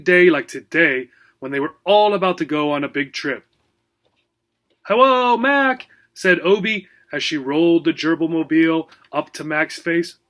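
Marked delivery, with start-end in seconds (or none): 4.8-5.9 bright high little gerbil voice; cheerful small rodent. Acted. Gerbil Obie speaks.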